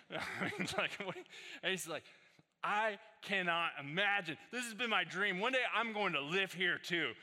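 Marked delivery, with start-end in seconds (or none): 2.6-7.1 loud, high-pitched awe; excited dad. Retold or imitated. Dad telling the pastor it's his dream town, loud and awestruck. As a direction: gush it out like a dream come true. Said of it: "he's just freaking out".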